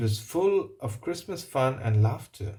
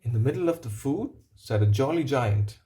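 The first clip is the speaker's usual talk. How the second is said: ordinary delivery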